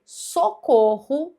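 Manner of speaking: socorro spelt out slowly, syllable by syllable. Shown as a demonstration. Teacher slowly splits socorro for the class. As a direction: drag out socorro so each syllable stands apart. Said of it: "com dois R's aqui na mesma sílaba".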